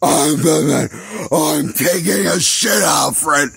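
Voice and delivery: hammed-up hoarse masked hero, pushed high and hard; Batman. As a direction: growl it hoarsely, as if straining on the toilet. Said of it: "This is how he sounds".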